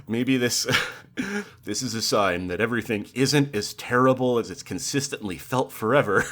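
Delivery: ordinary talk, nothing marked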